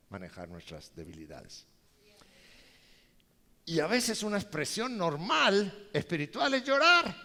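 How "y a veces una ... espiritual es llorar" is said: loud, high proclamation; preaching shout. Real, not acted. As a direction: proclaim it to the whole church. Preaching climax. (proclamación a gritos, más alto)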